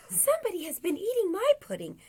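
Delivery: high, fast little bear voice; wee little baby voice. A narrator's reading. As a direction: squeak it out quickly as Baby Bear. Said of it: "in his wee little baby voice".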